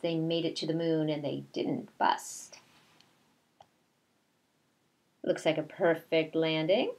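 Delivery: slow, sing-song read-aloud; reading to children. A narrator's reading. Teacher reading a picture book to her class, slow sing-song. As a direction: read the rhyme slowly to the class.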